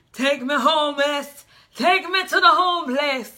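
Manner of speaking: high, loud mock begging; hammed-up plea. Reader acting out what she would say, loud theatrical plea. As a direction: belt it out with long vowels.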